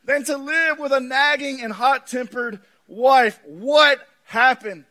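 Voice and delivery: high, loud preaching build; impassioned pulpit preaching. Acted. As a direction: preach it out with full force. Preaching at full force.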